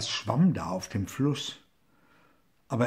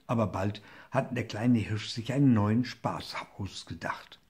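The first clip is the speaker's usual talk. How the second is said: ordinary delivery, nothing marked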